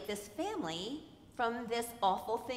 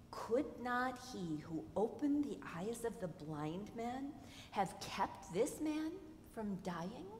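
softer, murmured doubting question; imitated hushed whisper; 8 dB quieter than usual. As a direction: mutter it so Jesus won't hear. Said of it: "they whisper, I think they whisper".

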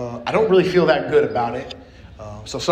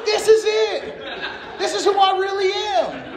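much higher, laughing through the words; laughing realization; slower than usual. Retold or imitated. Realizing in the food line that this is him, laughing. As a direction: laugh at the sudden truth. Epiphany in the soup kitchen line.

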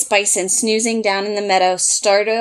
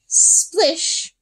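high splish; 6 semitones higher than usual. A narrator's reading. Splashing sound word.

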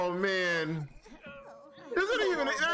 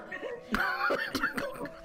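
high guilty giggle. Real, not acted. Guilty laugh at cruel game.

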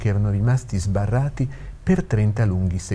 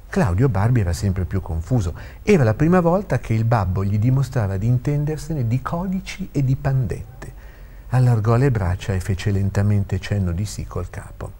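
usual speaking voice

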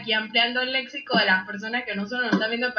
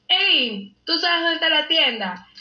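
calling out a question; 6 semitones higher than usual. Retold or imitated. Asking a neighbour where the shop is, called out high. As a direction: shout the question across the street.

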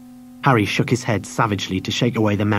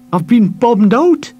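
put-on slow, high, loud tearful boy; crying boy; 11 semitones higher, 5 dB louder, slower than usual. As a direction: blurt it through tears.

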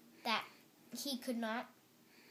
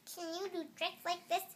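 higher-pitched boastful question; crying out; 6 semitones higher than usual. A narrator's reading. Toad boasting to Frog from the wire, high cry. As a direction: show off with a raised pitch. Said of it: "cried Toad".

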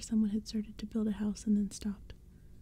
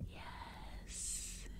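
soft, long sibilant hiss; hissing whisper; 16 dB quieter than usual. A narrator's reading. Second pumpkinhead agreeing with its partner, hissing. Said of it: "his partner hissed".

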